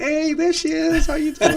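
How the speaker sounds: mock her lighting up with a smile; imitated smiling greeting. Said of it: "then she smiles like".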